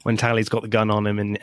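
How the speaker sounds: ordinary delivery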